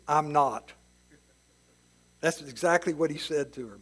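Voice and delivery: ordinary delivery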